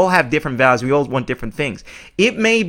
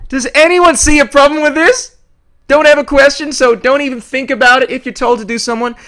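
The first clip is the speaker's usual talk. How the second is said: high, loud, fed-up yelling; loud and incredulous outrage; exasperated shout; 10 semitones higher, 7 dB louder than usual. Real, not acted. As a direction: blast the question at the clip. Ranting at a clip's logic.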